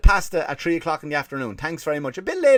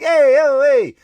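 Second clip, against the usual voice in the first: high, loud hammed-up street hawker calling; Italian waiter call; 12 semitones higher, 10 dB louder, much slower than usual. Little Italy tout hailing passing tourists, loud hammed-up call. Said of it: "It's like".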